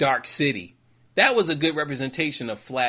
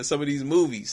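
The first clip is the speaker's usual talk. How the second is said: ordinary talk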